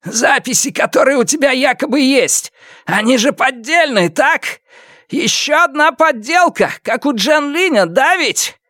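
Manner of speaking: high, strained, insistent voice; agitated villain questioning. A narrator's reading. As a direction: press him for confirmation, pitched very high. Villain interrogates hero from cover. (настойчивый допрос высоким, напряжённым голосом)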